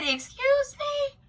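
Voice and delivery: retold outraged surprise, played big; indignant surprise. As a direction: act affronted, stretching it out. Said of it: "I said".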